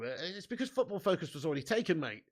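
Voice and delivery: normal talking voice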